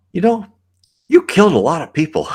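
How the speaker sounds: lightly voiced medium, high and loud on the first words; imitating the medium. Medium telling the speaker he killed people, mock cheerful. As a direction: mimic the medium's cheerful bluntness. Medium's blunt past-life verdict.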